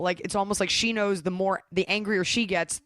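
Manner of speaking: ordinary talk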